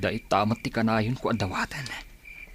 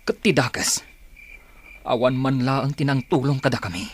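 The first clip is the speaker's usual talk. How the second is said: louder, rising bitterness; bitter outburst; 3 semitones higher, 4 dB louder than usual. Acted. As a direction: let the resentment push out. Recalling family's suffering. (nagunget nga ikkis)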